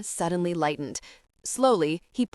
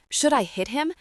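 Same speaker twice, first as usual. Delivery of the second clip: higher, more urgent yelp; panicky repeated scream; 7 semitones higher than usual. A narrator's reading. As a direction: press the question up in alarm. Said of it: "Tabitha screamed".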